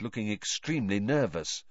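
plain reading voice

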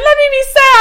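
high, whiny plea to be left sad; hammed-up begging to stay sad; mock sad plea. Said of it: "she's like".

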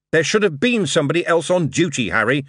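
mildly shouting the blame at Harry; shouted. A narrator's reading. Moist scolding Harry King in his office, a mild acted shout. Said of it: "he shouted".

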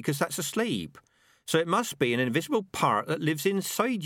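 high-pitched rat working out a theory; philosophical rat. Acted. Rat explaining dreams to the other rats, earnest. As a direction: reason it through eagerly as a rat.

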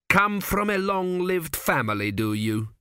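high opening that drops on the tag question; sarcastic. A narrator's reading. King to the ancient priest, sarcastic. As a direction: needle him with mock interest. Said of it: "said Tepikaimon sarcastically".

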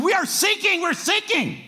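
high, loud cry of we're sinking; captain shouting to the Coast Guard that they're sinking; panicked radio call. Retold or imitated.

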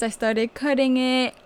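ordinary talk, nothing marked

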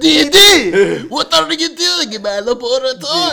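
very high, loud, cartoon girl voice; cartoon character impression. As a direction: squeal like a bratty cartoon sister. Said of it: "Dexter's Laboratory".

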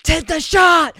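a high, loud, hammed-up yell; mock shout. Harriet ordering the shot down the line, hammed-up shout. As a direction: bellow the order in a joking overplay. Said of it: "But she doesn't say it like that".

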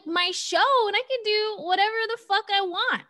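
high, cocky, defiant aside; mock defiance. Real, not acted. Host playing up her own defiance, a hint of swagger. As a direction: toss it off with swagger. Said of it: "I got off my high horse".

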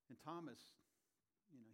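usual speaking voice

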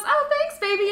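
high, sugary thank-you; imitating a colleague's high sugary reply; sweet fake thanks. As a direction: answer way up high, syrupy. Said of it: "she goes".

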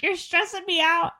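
high, stressed-out protest; playfully frazzled, high voice; exasperated complaint. Retold or imitated. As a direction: complain to the teasers, pitch jumping up. Acted retort to teasing relatives.

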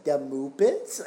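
high loud silly dad voice; dad impression. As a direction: ham up the dad catchphrase. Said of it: "he would just say".

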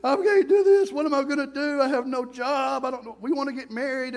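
high, loud despairing wail fading down; young man wailing he is a failure; despairing cry. Retold or imitated. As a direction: cry out in failure, then trail off. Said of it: "I'm like".

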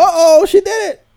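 loud, high-pitched burst of excitement; excited cheering. Real, not acted. Cheering the dog for getting up here, excited and loud. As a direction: exclaim it loudly, voice shooting up.

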